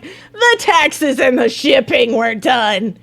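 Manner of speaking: loud, slow, drawn-out put-on voice; hammed-up voice for the funding site, loud and stretched; mock announcement. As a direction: boom each word out as a mock proclamation. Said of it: "It's like".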